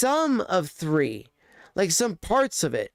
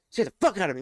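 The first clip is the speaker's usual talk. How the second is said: normal talking voice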